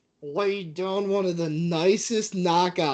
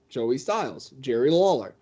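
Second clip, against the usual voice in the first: ordinary delivery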